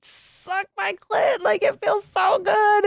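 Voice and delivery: high, slow mock cry of pleasure; hammed-up ecstatic cry; imitated screaming. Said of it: "And I'm screaming".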